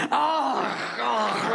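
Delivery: high, shouted "oh" with a guttural rasp; beastly gulping roar. Retold or imitated. Lemur picking up three crabs, beastly roar. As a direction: roar like a devouring animal.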